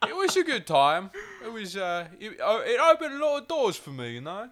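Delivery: high-pitched mock Cockney chatter; Lena Headey. Mock actress reminiscing about her career, hammed-up Cockney. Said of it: "that's what they sound like".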